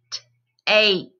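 T, ape split into onset and rime; segmented word. Shown as a demonstration. Teacher sounding out a word for students, segmented. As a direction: say T then ape apart. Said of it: "What long vowel sound do we hear".

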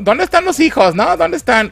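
voz muy aguda y preocupada; padres preocupados. Retold or imitated. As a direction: imita a los padres buscando a sus hijos. Padres buscan a los hijos. (padres buscando a sus hijos, voz aguda y angustiada)

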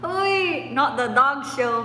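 loud playful protest. Real, not acted. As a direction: push back loudly at the chat. Protesting chat joke.

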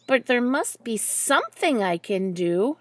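ordinary delivery, nothing marked

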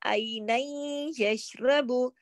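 "आई नई यशरबु" very slowly, with a long nasal y; idgham recitation. Shown as a demonstration. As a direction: draw out "आई नई यशरबु", merged at the y. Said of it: "आई नन नहीं पढ़ेंगे".

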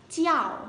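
"chiao" run together as one syllable; blended syllable. Shown as a demonstration. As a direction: blend the parts smoothly into a single "chiao". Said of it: "Đọc liền và nó sẽ ra". (đọc liền "chiao" thành một âm)

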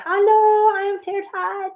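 high, cutesy pet voice; voicing the cat. Owner voicing her cat greeting the viewers, high and cute. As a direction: speak for the kitten in a high little voice. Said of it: "She wants to say hi".

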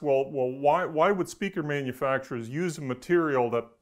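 usual speaking voice

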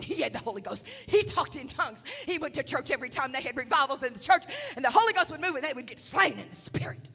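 loud, insistent testimony; fervent preaching. Real, not acted. Preacher testifying that young [name] had the Spirit, fervent. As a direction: surge into preaching fervour.

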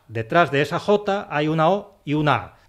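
ordinary delivery

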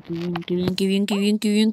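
normal talking voice

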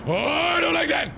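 high startled yelp of real alarm; real spooked outcry at a creature; startled fright. Real, not acted. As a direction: jump back from something in the dark water. Startled by creature in dark water.

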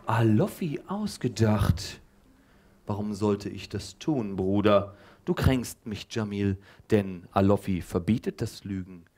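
a hint of a wounded zealot; lightly solemn prophet. Teacher as offended prophet.

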